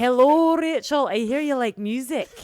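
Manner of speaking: hammed-up celebrity on the phone. Imitating the singer calling her, high and loud.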